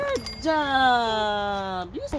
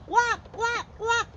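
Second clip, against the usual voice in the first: duck quack imitation; 8 semitones higher than usual. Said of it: "What is the duck say?".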